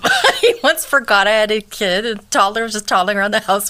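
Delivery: high, giggling through the words; laughing. Real, not acted. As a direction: laugh as you talk. Laughing while telling story.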